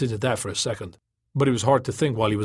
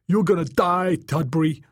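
raised, high-pitched threat; angry threat; 6 semitones higher than usual. A narrator's reading. Joey, stripped and dangling, threatens Tom, angry raised voice. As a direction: threaten him in a heated raised voice.

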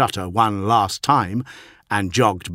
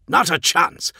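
high, sharp refusal; snapped; 9 semitones higher, 4 dB louder than usual. A narrator's reading. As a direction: snap back angrily. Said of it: "snapped Tyro".

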